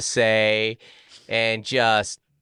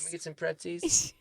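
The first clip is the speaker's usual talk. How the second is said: put-on kid voice; 6 semitones higher, 10 dB quieter than usual. Mimicking kids asking for pretzels.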